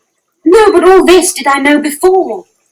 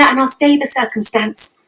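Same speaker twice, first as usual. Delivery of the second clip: usual speaking voice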